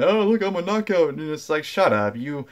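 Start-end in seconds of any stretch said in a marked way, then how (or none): 0.0-1.1 high, sneering mock of a bragging woman; mocking show-off. Vlogger mocking a wrestler showing off, high sneer. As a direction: mimic her preening in a high put-on voice. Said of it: "for her to go around parade that".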